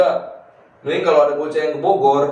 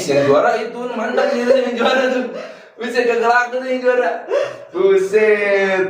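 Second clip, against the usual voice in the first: laughing; 7 semitones higher than usual. Real, not acted. Laughing about Juara cigarettes.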